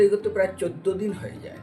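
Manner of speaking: normal talking voice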